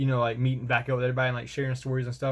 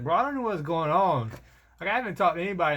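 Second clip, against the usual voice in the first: yelling back; 6 semitones higher than usual. Retold or imitated. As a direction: yell back, totally confused. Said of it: "I'm yelling back".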